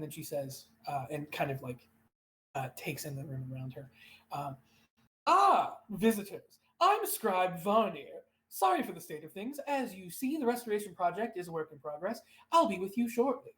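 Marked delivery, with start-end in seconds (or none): 5.3-13.5 higher, animated elf scribe voice; older elf scribe, welcoming visitors. Acted. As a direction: greet visitors as a busy elf scribe, pitched up.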